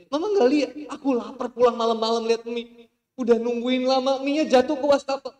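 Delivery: high, very loud shouted complaint; husband shouting at his wife about the noodles; angry husband. Acted. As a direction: rant furiously over the fallen noodles. Husband's comic angry rant.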